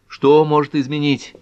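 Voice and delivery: "Что может изменить" high and loud; "Что может изменить" as a high, forceful echo; taken-aback question. Acted. Bewildered Ellery repeats the question.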